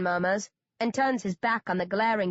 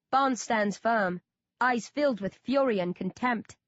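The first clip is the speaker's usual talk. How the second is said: usual speaking voice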